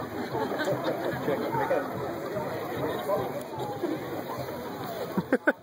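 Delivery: a long easy laugh. Real, not acted. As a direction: laugh it off warmly. Laughter after calming the parties.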